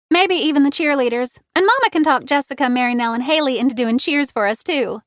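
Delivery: high, loud playful teasing. A narrator's reading. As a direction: tease her, quick and bright. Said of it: "Lily teased".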